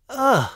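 high-pitched ugh of disgust; disgusted cry. A narrator's reading. Duchess bites a bad roll, disgusted cry. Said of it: "cried the Duchess".

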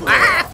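short high scream; sudden high cry; pained yelp. Real, not acted. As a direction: yelp high as you get hit. Hit in the game.

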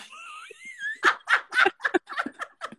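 long high laugh; can't hold it in, bursts out laughing. Real, not acted. Host breaking up reading pooper duper script, long high laugh.

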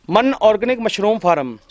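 normal talking voice

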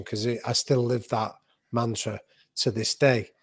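ordinary delivery

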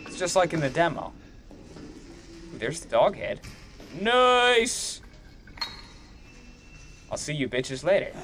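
ordinary delivery

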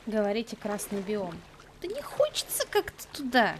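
ordinary talk

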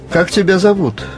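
usual speaking voice

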